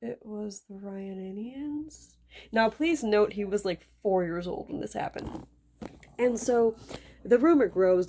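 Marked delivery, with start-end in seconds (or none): 0.0-2.1 put-on unsure child. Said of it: "Hansel's just like".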